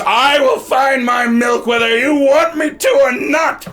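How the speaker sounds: loud, slow indignant shout from a minotaur; Muna, upset. Acted. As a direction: declare it defiantly at full voice. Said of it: "she's even more upset now".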